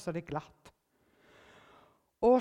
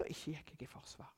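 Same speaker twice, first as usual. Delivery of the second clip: hushed aside; 4 semitones lower, 16 dB quieter than usual. Real, not acted.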